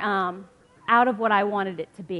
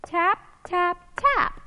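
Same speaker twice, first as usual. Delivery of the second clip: high tap tap tap; tapping knocks; 8 semitones higher, 4 dB louder, much slower than usual. Acted. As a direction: mimic a gentle knock. Knocking sound in story.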